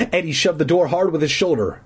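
plain reading voice

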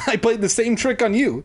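high, excited outburst; excited high exclamation; cried out. Acted. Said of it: "she cried".

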